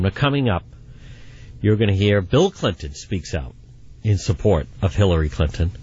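ordinary delivery

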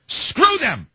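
a sharp high, furious jab; angry outburst. Real, not acted. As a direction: spit it out in real anger. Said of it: "don't get me started".

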